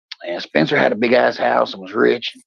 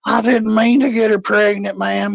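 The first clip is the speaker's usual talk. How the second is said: drunk fake confession; 9 semitones higher than usual.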